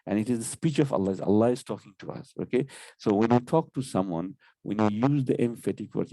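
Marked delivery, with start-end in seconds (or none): none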